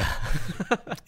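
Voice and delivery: a short burst of high laughter; real laugh. Real, not acted. Laughs at his own story. (risa corta y aguda)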